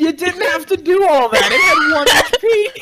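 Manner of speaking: high, loud protest; exasperated shout. Real, not acted. Game master protesting the overkill on a centipede, exasperated shout. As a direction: raise your voice in exasperated disbelief.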